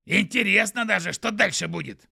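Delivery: loud, squeaky skunk voice. Acted. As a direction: chirp like an eager little skunk. Скунс Пук говорит. (голос скунса)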